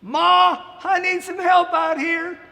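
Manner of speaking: high, loud shout to his wife; farmer calling out. Acted. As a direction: call out across the field. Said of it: "So he called his wife".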